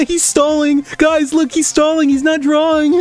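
high mocking singsong of the chat. Mimicking chat taunting the streamer, high and mocking. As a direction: taunt him like a gloating viewer. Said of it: "here you guys are going like".

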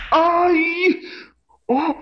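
high yelps of pain; pain cries. Acted. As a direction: cry out after a slipper hit. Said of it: "ficou marcado".

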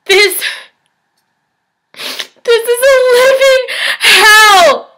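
high, loud cry building to a scream; anguished outburst. Acted. Patient declares their life a living hell, anguished and screaming. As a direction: build from desperate cry to full-out screamed despair.

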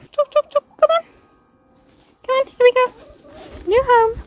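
high sing-song hen call; calling chickens. Real, not acted. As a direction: coax the hens in, high. Calling hens into new coop.

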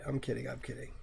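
ordinary talk, nothing marked

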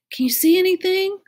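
high, loud called question; calling out. A narrator's reading. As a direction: call it up a shaft. Calls up the shaft.

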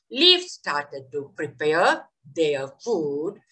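lively story narration for a class; storytelling to children. Acted. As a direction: tell it like a fairy tale to kids.

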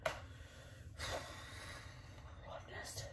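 sighing, worn-out whisper; tired whisper. Real, not acted. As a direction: breathe it out, drained. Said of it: "That's really how I feel".

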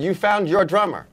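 high call thrown down the table; retold yell. Drummer putting himself forward to [name] at dinner, yelling. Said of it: "So I yell down the table".

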